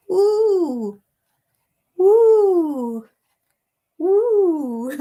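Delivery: high, loud hooting "woo"; wood pigeon call. Shown as a demonstration. Imitating the wood pigeon for viewers, high hoot. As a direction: hoot like a pigeon, falling away. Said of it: "I'm sure I've got that right".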